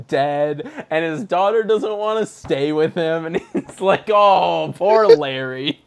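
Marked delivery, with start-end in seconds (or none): 4.1-5.8 mock pity.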